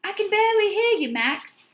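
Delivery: fast, high phone voice. A narrator's reading. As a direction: sound tinny over the line. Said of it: "came Tony's voice from the phone".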